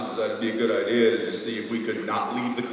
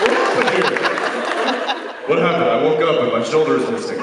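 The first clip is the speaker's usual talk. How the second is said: high, bewildered mock panic; a dazed guy who lost his shoulder; confused victim; 8 semitones higher, 7 dB louder than usual. Acting confused injured victim.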